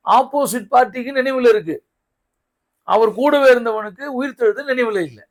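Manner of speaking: loud, high-pitched preacher's challenge; impassioned preaching. Real, not acted. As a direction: drive it home to the congregation, high and forceful. Preaches at full force.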